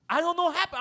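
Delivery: loud, high, repeated complaint; mock yelled excuse. Cellmate yelling his excuse across the cell. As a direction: holler it from the cell. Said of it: "and then I hear".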